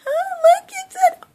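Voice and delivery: high squeal of wonder; delighted surprise. Real, not acted. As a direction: gasp at how golden it is. Admiring the golden product.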